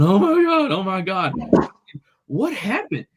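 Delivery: put-on high, shrill-free frantic cries of an injured old lady; panicked old lady. Old lady on ground after hit.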